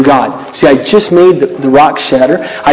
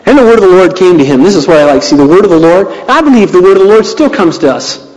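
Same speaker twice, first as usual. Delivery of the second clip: normal talking voice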